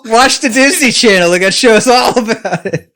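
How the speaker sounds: loud, high mocking impression of Chris; imitating Chris. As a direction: ham up a friend's voice. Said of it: "Chris is like".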